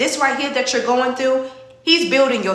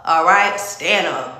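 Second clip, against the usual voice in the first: usual speaking voice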